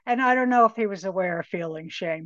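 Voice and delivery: ordinary talk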